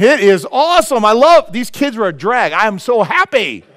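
loud, high, hammed-up gushing; mock over-joyed. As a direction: fake ecstatic happiness at full volume. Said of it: "He wasn't going".

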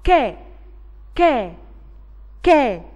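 three separate punched "ك" syllables, each falling; letter sound demo. Shown as a demonstration. Teacher showing pupils kaf with fatha, demonstrating "ك". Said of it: "فانا هنطقها".

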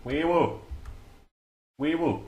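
high sing-song wee woo like a police siren; goofy siren call; siren imitation. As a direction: mimic a siren playfully. Playful siren noise in game.

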